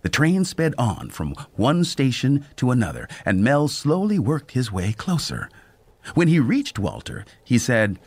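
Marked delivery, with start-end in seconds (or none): none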